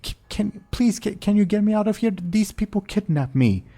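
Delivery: high, fast, panicked plea; pleading captive. Acted. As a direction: beg desperately to be freed. Captive John pleads for rescue.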